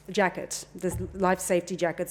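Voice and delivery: ordinary talk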